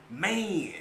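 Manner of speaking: Texas drawn-out "man"; Texas-accented man; Texas slang. Shown as a demonstration. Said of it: "how we say that".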